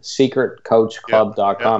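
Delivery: deliberately slow web address; very slow, careful. Real, not acted. Repeating the website so nobody mishears it, very slow and careful. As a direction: draw each word out for clarity. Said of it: "say that out very slowly".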